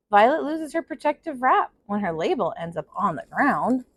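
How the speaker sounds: put-on steady storyteller reading to children; story narration. A narrator's reading. Teacher reading a picture book to her class, in a gentle storytelling voice. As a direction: read it out like circle time for kids.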